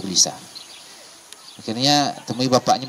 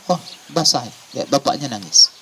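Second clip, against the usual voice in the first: ordinary delivery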